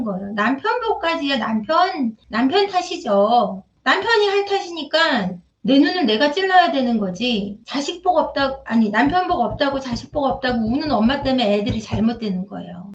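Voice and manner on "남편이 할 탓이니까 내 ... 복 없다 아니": ordinary talk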